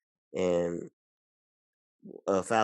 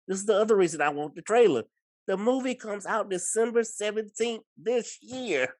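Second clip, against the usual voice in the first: high-pitched, insistent correction; emphatic insistence; 13 semitones higher, 4 dB louder than usual. Real, not acted. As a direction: push the point home, voice way up high. Insists movie is out this year.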